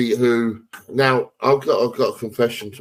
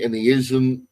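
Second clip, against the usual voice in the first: ordinary talk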